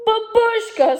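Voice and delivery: high, loud tearful cry of "Babushka"; sobbing girl. A narrator's reading. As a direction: cry out for grandma. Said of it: "sobbed Natasha".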